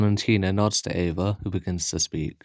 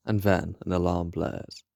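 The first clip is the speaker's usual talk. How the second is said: ordinary delivery, nothing marked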